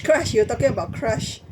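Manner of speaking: ordinary talk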